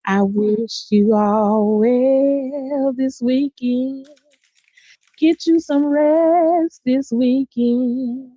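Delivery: slow, breathy-sounding seductive ad read; sultry perfume ad. Pretend. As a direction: purr it slowly like a perfume commercial. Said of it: "that's a sultry voice right there".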